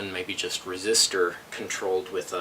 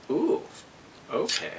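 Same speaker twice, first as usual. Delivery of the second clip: surprised ooh; 7 semitones higher than usual. Real, not acted. Surprised on opening device.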